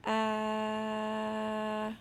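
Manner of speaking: steady, monotone "uhhh" held long; sustained "uh" sound. Shown as a demonstration. Teacher models the sounds on the page, sustained "uh". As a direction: hold the vowel on one even note. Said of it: "tell me the sounds again".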